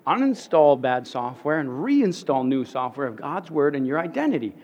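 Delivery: ordinary talk, nothing marked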